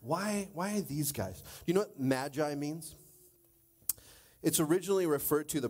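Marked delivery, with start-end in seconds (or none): none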